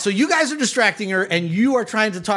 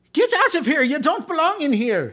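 high, scolding order; outraged matron; 7 semitones higher than usual. Acted. Matron ordering intruder out, outraged. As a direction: shoo them out in a raised pitch.

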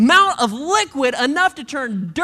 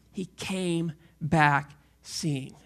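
slow, tender; 9 semitones lower, 5 dB quieter, much slower than usual. Acted. Repeated slowly.

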